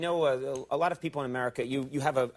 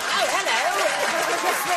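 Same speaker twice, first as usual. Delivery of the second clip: high, slow, put-on posh English "Oh, hello"; fake English accent; 7 dB louder, much slower than usual. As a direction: stretch it out in a mock English accent. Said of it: "people like [name] who ... be like".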